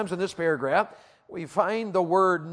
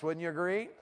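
ordinary talk, nothing marked

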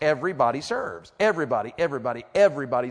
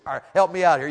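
ordinary talk, nothing marked

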